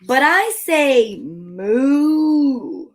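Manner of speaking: long, slow drawn-out moo; cow mooing. A narrator's reading. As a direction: stretch the words like a lowing cow. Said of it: "said the cow".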